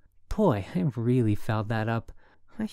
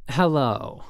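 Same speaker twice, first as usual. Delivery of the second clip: curt woman's hello, a bit louder; unfriendly; 3 semitones higher, 5 dB louder than usual. A narrator's reading. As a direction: answer the phone none too friendly. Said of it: "None too friendly".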